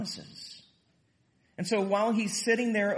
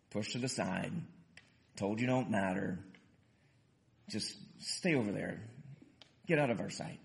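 low, soft, with long pauses; hushed retelling; 7 semitones lower, 6 dB quieter than usual. Retold or imitated. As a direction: let each phrase land quietly. Voicing how outcasts are treated.

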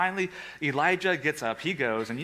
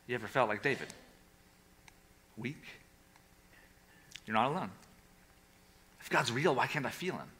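hushed, intimate; 4 semitones lower, 8 dB quieter, faster than usual. Real, not acted. Preacher softens on doubt.

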